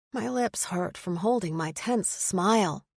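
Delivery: plain reading voice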